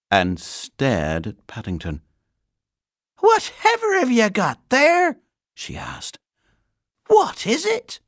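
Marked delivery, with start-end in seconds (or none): 3.2-5.2 loud, very high question; astonished housekeeper. A narrator's reading. Housekeeper spotting the bear, astonished. 7.1-8.0 high, loud, baffled question; astonished housekeeper. A narrator's reading. Housekeeper staring at the bear, astonished.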